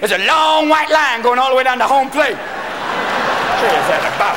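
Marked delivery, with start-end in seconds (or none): 2.4-4.4 stoned wonder. Acted. Stoned player, slowed.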